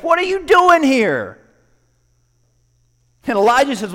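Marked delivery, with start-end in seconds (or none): 0.0-1.4 louder, higher and slow; firm divine question. Acted. God insists Elijah answer, firm and slow. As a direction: press the question home.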